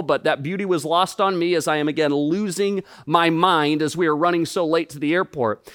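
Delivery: ordinary delivery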